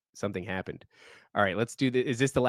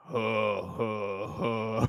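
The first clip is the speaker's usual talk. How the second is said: slow, deep "ho, ho, ho" chuckle; low mock laugh from the dark; super low laugh; 3 dB quieter than usual. A narrator's reading. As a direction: imitate an ominous low laugh in separate beats. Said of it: "And then it did it again".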